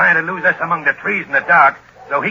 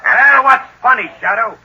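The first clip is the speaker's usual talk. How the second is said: angry shout; 9 semitones higher, 8 dB louder than usual. Acted. Gunman angered by the laughter.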